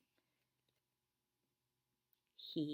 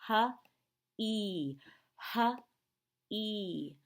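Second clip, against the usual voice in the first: normal talking voice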